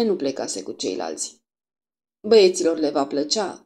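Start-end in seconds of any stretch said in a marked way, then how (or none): none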